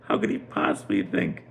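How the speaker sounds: high, swooping, incredulous mockery; scoffing king. King mocks the idea Reuven would return, scoffing. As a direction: scoff in stretched, leaping pitch. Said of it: "the king is laughing to himself".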